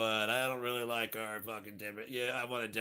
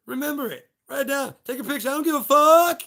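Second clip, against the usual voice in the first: yelled quote; 15 dB louder than usual. Pretend. To the audience, yelling the movie line all out. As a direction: shout every line, building to a scream on fuck. First, shouted telling of the quote.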